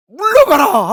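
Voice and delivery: high, loud, ringing command; commanding rebuke. Acted. Preacher thunders Jesus' rebuke at the congregation, high and loud. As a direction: drive him off with a sharp order.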